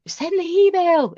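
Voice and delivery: high, slow, chanted urge to send it; cheering her on. Retold or imitated. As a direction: egg her on like an excited crowd. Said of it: "We were all kind of".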